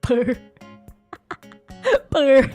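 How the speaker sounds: ordinary talk, nothing marked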